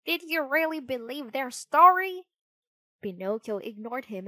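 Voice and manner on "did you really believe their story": high, loud squawking parrot voice. Acted. Parrot jeering at the digging puppet, squawking voice. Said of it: "squawked a parrot".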